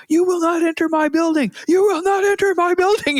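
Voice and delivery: guard the building like a soldier; in-character soldier. Retold or imitated. Streamer orders enemies off his building, high mock command. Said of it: "start laughing at him 'cause he's".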